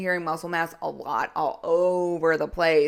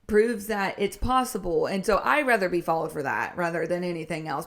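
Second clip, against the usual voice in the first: ordinary delivery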